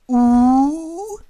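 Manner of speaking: "oo" drawn out long, high and loud. Shown as a demonstration. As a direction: hold a long bright oo. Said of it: "So it's more like a long oo".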